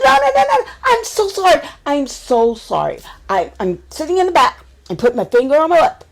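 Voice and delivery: high, loud frantic apology; panicked pleading. Retold or imitated. Drunk friend begging the driver not to go home, high frantic pleading. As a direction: beg him not to turn around.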